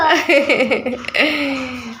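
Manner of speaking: helpless chuckle; amused laugh. Real, not acted. Mother amused by her child brushing off the question, chuckling.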